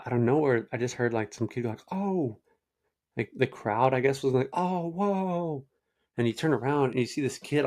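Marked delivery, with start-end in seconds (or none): 1.9-2.4 high mock oh of surprise; a kid in the stands reacting; hint of a startled kid; imitated crowd gasp. 4.5-5.6 high mock oh whoa; the crowd reacting in shock; hint of a startled crowd; imitated crowd gasp.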